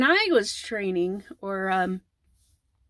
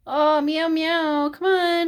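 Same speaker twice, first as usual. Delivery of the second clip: high meowing back at the cat; singsong kitty talk, pitched up; pet voice; 6 semitones higher, slower than usual. Real, not acted. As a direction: coax the cat in a raised, playful voice. Talking meows to the cat.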